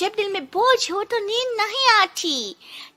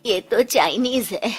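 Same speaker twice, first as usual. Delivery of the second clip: lower, hushed voice of a suspicious wife; annoyed wife; 7 semitones lower than usual. Acted. Wife to husband about the Chinese volunteer, low disapproving grumble. Sick man's wife objects.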